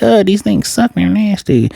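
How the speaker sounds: a mock childish whine; whiny kids. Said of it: "Damien and Bucktooth and Conehead, like".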